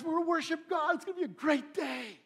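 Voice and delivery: high, bright-eyed anticipation of the big day; eager worshipper. Acted. Worshipper telling his family about temple day, excited. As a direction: sound like a family heading to worship, thrilled.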